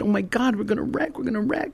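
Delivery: high, frightened pleading in a crash; half-wail the warning in fear; high panicked retold plea; panicked cry.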